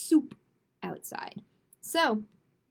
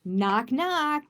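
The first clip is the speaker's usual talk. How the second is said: picture-book read-aloud; 3 semitones higher, 5 dB louder than usual. A narrator's reading. Reads a rhyming picture book.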